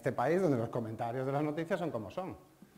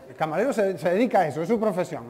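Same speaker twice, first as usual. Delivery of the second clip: laughing emphasis; 4 semitones higher, 11 dB louder than usual. Real, not acted. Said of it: "amused emphatic teacher".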